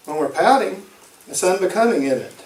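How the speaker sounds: normal talking voice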